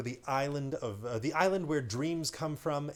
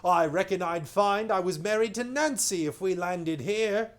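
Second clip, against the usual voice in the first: sailor voice; 8 semitones higher, 8 dB louder than usual. Acted. Sailor speaks in story.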